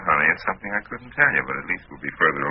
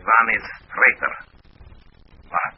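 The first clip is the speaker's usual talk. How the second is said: put-on stern Russian accent, pitched up; best Russian accent; 5 semitones higher, 4 dB louder than usual. Fake agent warning the professor of a traitor, Russian accent. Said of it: "in my best Russian accent".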